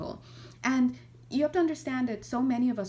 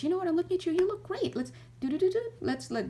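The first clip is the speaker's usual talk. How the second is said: bright, much higher coaxing big-sibling voice; cheery big brother; 10 semitones higher, faster than usual. Retold or imitated. Big sibling comforting a fallen child, high cheery coaxing. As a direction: scoop up the crying kid and pull them into a hug. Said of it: "and they're like".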